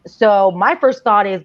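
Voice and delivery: ordinary delivery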